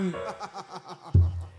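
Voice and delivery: laughing. Real, not acted. Laughs after barking.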